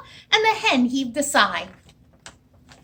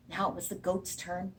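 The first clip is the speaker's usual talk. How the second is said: ordinary delivery, nothing marked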